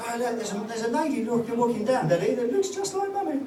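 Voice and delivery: put-on a light high kid's voice, excited; imitating a small child. Son at the window telling his dad, lightly voiced child. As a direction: voice the boy pointing out the window. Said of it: "he goes".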